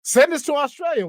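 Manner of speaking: high, loud eager begging; excited plea. Acted. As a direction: shout it out like a pitch.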